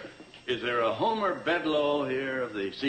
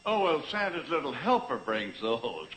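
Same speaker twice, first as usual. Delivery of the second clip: normal talking voice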